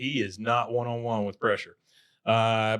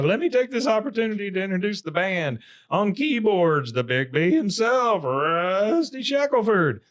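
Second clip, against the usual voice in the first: high announcer voice, slowing on the names; imitated band introduction; 8 semitones higher than usual. Dale introducing the band, imitated showy announcer. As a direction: stretch out a hammed-up stage introduction.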